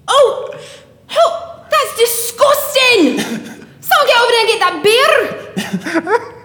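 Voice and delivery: loud, very high, outraged Scottish cry; Scottish accent. Shown as a demonstration. Singer demonstrating accents for the host, shouted Scottish accent. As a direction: yell it in a hammed-up Scottish accent. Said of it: "My Scottish one's pretty good".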